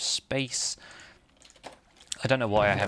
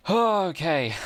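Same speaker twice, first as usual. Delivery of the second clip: laughing okay; 7 semitones higher than usual. Real, not acted. Laughing after nearly falling in lava.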